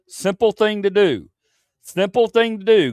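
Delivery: sharp, high, loud scolding; irritated shout. Real, not acted. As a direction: snap it out at the chat. Rising irritation at chat.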